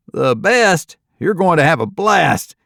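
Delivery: high, loud excited cheer; departing guest cheering on the newcomers. Acted. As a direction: gush about the fun you had. Cheering departing group member.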